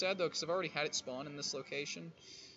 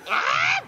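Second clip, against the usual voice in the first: startled scream; 15 dB louder than usual. Real, not acted. Bitten by creature.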